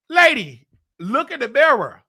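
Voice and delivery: high and loud, calling her out; sassy retort. Retold or imitated. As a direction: throw it back at her with sass. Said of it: "I'm just looking at her being".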